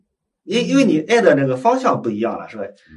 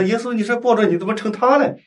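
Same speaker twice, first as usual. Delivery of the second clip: higher-pitched retold complaint; mock indignation; 7 semitones higher than usual. Retelling a woman's complaint to Jesus in prayer, mildly indignant. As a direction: lightly act out an upset protest. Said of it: "他就生气".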